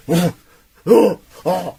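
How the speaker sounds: loud, high gagging heaves; mock retching. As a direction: imitate someone coughing up a hairball. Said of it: "like he's coughing up a hairball".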